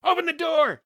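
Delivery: urgent high, loud yell; shout over shoulder. A narrator's reading. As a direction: shout the order to someone behind you. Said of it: "Shotgun shouted".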